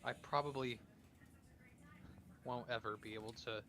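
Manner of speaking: soft, halting question; hushed, hesitant in-character resignation; subdued, resigned character. Acted. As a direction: ask quietly, half expecting a no. Stranded character asking about home.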